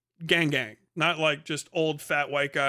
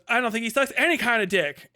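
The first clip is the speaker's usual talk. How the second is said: high, louder camp retort; a mildly camp indignant comeback; 7 semitones higher, 4 dB louder than usual. Retold or imitated. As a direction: toss it off with theatrical flair.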